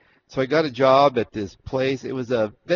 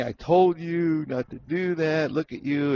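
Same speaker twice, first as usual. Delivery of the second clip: slow, higher-pitched scolding, imitated; mad father; 5 semitones higher, slower than usual. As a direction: mimic a father telling off his child, drawn out. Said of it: "he's mad at me".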